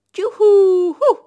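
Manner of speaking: high, loud wedding whoop; groom's whoop. Acted. Groom whooping at the wedding feast, loud and high. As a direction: let out a joyful wedding cry, high and loud. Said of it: "a vőlegény éppen elrikkantotta magát".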